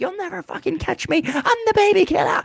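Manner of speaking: very high, loud cartoon villain taunt; mock fleeing villain. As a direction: shriek it like a fleeing cartoon crook. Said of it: "jump out of a back window and be like".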